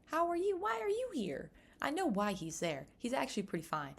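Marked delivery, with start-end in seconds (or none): 0.0-1.5 playfully baffled, climbing up high; startled, high-pitched disbelief; mock surprise. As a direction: voice jumps high on how are you and why are you here. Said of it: "I said".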